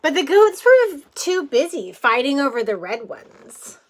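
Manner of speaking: ordinary delivery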